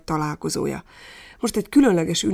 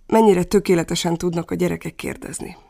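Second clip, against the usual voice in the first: normal talking voice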